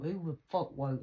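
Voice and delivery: ordinary talk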